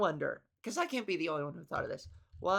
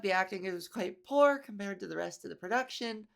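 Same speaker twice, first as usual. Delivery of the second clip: ordinary talk, nothing marked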